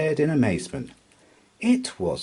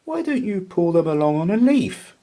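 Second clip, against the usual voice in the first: helpful squirrel; 11 semitones higher, 5 dB louder than usual. A narrator's reading. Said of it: "suggested Squirrel".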